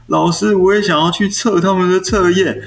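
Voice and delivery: put-on whiny child. Crying pupil begging teacher to take the test, whiny. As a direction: plead like a teary schoolkid.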